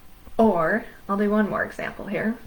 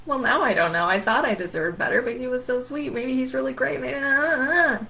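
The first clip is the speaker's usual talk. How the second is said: hammed-up anxious dithering, rising higher; mock panic spiral, high and rambling; 7 semitones higher, faster than usual. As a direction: climb in pitch until the words dissolve into babble. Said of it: "you're back in a spiral".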